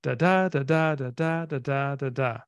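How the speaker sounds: "Da, da" in pairs, the second of each stressed; iambic rhythm. Shown as a demonstration. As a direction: beat out iambic pentameter on "da", weak then strong. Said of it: "that goes like this".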